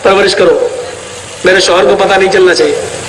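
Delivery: a mother secretly warning her sister; wife's instruction to her sister. Retold or imitated. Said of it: "میری بیوی نے ... اپنی بہن کے یہاں بھیج دیا". (بیوی کی دبی ہوئی، نیچی اور تیز آواز)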